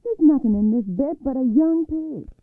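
high, very loud monster's complaint; loud, indignant high-pitched shout; outraged yell. A narrator's reading. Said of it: "he yelled".

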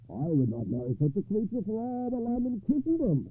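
a knight sneering at the match; scornful knight. A narrator's reading.

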